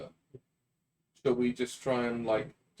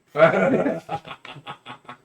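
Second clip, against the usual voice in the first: loud laugh; 4 semitones higher, 7 dB louder than usual. Real, not acted. Reacting to failed ram.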